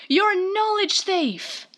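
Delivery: high, drawn-out mock accusation; Wizamon set off. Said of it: "that sets Wizamon off".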